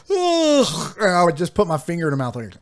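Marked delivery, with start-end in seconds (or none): 0.1-1.0 high, whiny mock groan of boredom. Husband imitates wife's groan in the car, mock whine. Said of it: "and she'd go".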